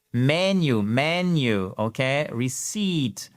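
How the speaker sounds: ordinary delivery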